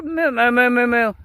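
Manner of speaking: low, repeated cow moos; imitated mooing. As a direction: moo low at the herd. Mooing at the cattle.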